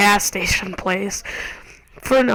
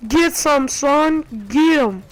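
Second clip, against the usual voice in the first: higher, louder trash-talk cheer; replaying the hype, a touch louder and higher; hyped gamer yell; 5 semitones higher, 3 dB louder, slower than usual. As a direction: pump yourself up mid-firefight. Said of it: "And then I was like".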